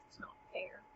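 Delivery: hushed, breathy grumble of unfairness; whispered complaint. Retold or imitated. Clown whispering his gripe about rank. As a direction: mutter it under the breath, sulking.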